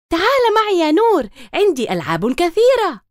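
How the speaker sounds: high, loud excited invitation; eager call. A narrator's reading. Girl calls the boy to her toys, excited. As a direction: call him to come play. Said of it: "لكن تمارا هتفت".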